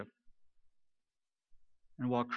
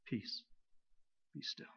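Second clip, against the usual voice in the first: near-whisper, much softer and breathy; hushed, to us; 2 semitones lower, 14 dB quieter than usual. Acted. Preacher voicing Jesus speaking peace to us, hushed. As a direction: say it gently as if to one frightened person. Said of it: "He's talking to us".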